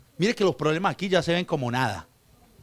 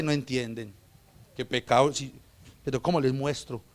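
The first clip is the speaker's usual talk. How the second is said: voice of God; 6 semitones lower, 3 dB quieter than usual. Acted. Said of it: "Y dice".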